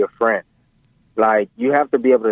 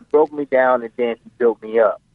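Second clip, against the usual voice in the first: ordinary delivery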